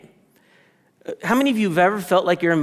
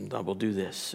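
low, much softer detached monotone; robotic God; 8 semitones lower, 12 dB quieter than usual. Retold or imitated. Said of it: "robotically making decisions".

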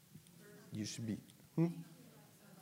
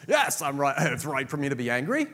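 stubborn Jonah; 9 dB louder than usual. Acted. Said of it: "Jonah still thinks the answer is yes".

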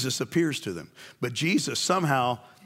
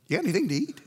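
casual Jesus; 6 semitones higher, much faster than usual. Acted. Jesus asking casually for food.

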